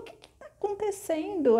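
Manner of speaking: high, startled king; a king bursting in, alarmed. Retold or imitated. King arriving at the execution, high and startled. King finds Thalia at the fire.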